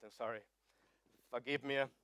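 ordinary delivery

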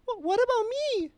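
high, whiny imitation of a complaining woman; mock whiny woman. Mocking the pink-haired woman in line, high whiny complaint. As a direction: squeak out a petty protest in mocking falsetto. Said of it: "is like this".

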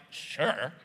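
low, grudging "Sure" dropped flat; reluctant sure. Retold or imitated. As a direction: say "Sure" as if you don't mean it. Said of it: "Is it like".

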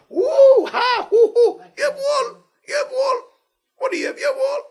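very high, loud squealing gawker; hammed-up falsetto wonder at the decor; mock awe. Rabbi mocking people thrilled by synagogue decor, mock awe.

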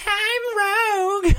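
very high, drawn-out mopey voice; emo voice. Retold or imitated. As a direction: moan it out, slow and high. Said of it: "her emo voice".

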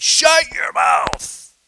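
high, slow, booming order; commanding shout. Retold or imitated. Gang leader shouting down the mocking teens, slow booming command. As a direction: bark it down to silence the crowd.

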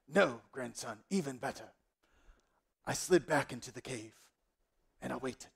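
grandfather drawing out his tale, often hushed; storytelling grandfather. Acted. Old David telling grandson how he lurked, suspenseful granddad voice. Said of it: "tell us the story".

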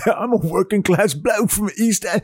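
high, sing-song East End bloke; East End accent. Acted. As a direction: put on a rough-guess East End accent. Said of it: "is that the East End accent".

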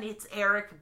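normal talking voice

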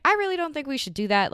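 quick, high-pitched protest; retold wary objection. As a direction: replay hurriedly heading off a bad topic. Retold objection to date's topic.

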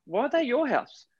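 put-on a confused kid asking why; puzzled child. Kid puzzled about the daughters.

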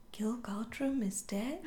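soft unvoiced whisper; a sly cat breathing the question.

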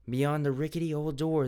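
ordinary delivery

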